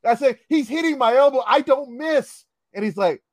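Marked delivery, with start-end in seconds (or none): 0.5-2.4 indignant insistence. Retold or imitated. Player argues with the ref.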